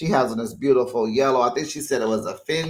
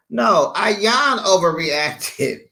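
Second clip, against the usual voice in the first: ordinary talk, nothing marked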